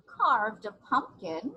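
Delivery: put-on slow, paced read-aloud for little kids; storybook reading. A narrator's reading. Teacher reading a picture book to kids, gentle storytelling. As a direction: read each line like a page turn.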